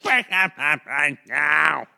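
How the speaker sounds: drawn-out holler; slow, hammed-up shout; shouted catchphrase. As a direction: stretch the call out into a long yell. Shouted catchphrase for a fantasy pick.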